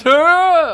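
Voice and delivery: high, very loud imitated cry; big drawn-out creature HUH; Goatman noise. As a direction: belt out Goatman's startled yelp. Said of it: "When he goes".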